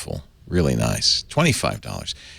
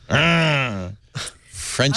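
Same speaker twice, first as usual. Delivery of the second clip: mock French grunt; 4 semitones higher than usual. Shown as a demonstration. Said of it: "You always have to grunt when you do it".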